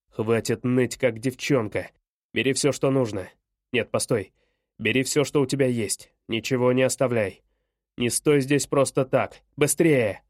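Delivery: low-pitched, impatient father giving orders; angry father. Acted. Father hurrying his son to pack, impatient and harsh.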